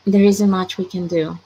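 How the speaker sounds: usual speaking voice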